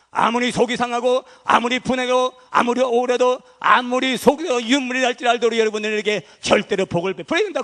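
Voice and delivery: loud, driving sermon voice; preaching at full force. Real, not acted. Preacher urging the congregation to bless, in full preaching force. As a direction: hammer each phrase home to the congregation.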